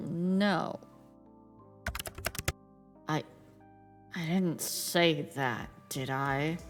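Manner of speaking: Grim Reaper voice. Acted. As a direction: aloof death god, calm and low. Streamer voices Death in game.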